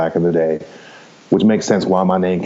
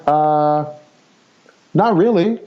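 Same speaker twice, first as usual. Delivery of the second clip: retold reply; 7 semitones higher, much slower than usual. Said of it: "and I'm like".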